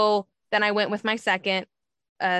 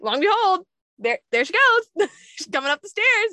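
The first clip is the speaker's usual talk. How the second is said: excited retelling; 7 semitones higher, 4 dB louder than usual. Real, not acted. Nanny arrives, teller laughing.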